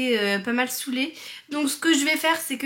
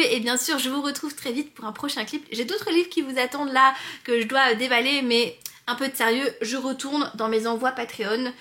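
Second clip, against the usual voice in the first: ordinary delivery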